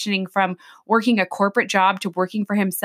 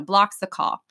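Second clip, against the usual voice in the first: ordinary talk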